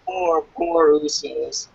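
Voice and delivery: ordinary delivery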